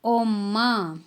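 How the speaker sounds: "ओम्मा" said clearly with held m; teacher modelling Korean "ओम्मा"; Korean word. Shown as a demonstration. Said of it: "mom को बोलेंगे ओम्मा".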